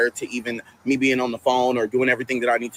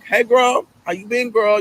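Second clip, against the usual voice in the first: high, breezy greeting; breezy fake-casual hello; playing it off casually; 9 semitones higher, 3 dB louder than usual. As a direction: act like nothing's wrong, light and high. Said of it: "trying to play it off".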